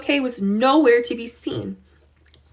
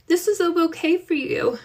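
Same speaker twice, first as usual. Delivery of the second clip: put-on higher, trembling girl's voice near tears; shaky voice; 8 semitones higher than usual. Girl shows her ruined bouquet to her aunt, shaky. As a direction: hold back tears while offering the gift. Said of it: "she said in a shaky voice".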